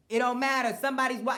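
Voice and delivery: fired-up rant voice; heated outburst. Real, not acted. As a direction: blurt it out hot. Heated rant about tracking.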